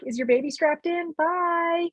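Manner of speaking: high, chirpy send-off voice; a lightly mocked cheerful nurse waving them out; breezy hospital staff. Nurses dismissing new parents, high chirpy mock cheer.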